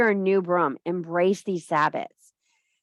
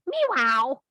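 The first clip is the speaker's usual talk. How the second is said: cat meow; 6 semitones higher than usual. Retold or imitated. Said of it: "that little cat hanging on to the branch going".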